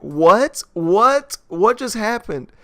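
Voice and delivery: high, loud baffled what; hammed-up confusion at the collapse; mock bewildered. Narrator mocks the scene's confusion, loud and high.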